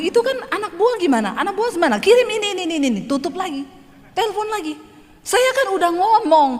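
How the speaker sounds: high, fast mock phone scolding; hammed-up boss nagging his staff; imitating rich businessman on phone. Rich man scolding staff on the phone, mocked high.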